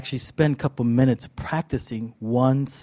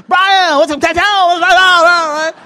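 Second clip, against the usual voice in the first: loud, high mock Brazilian accent; bad Brazilian accent; 9 dB louder than usual. Master greets his student, bad Brazilian accent yell. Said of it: "I can't do a Brazilian accent".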